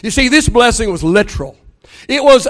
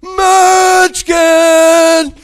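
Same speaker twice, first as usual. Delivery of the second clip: higher, drawn-out call of "Munchkin"; yelled out; 7 semitones higher, 3 dB louder than usual. Retold or imitated. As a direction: sing it out like two kids in unison. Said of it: "both of them yelled out".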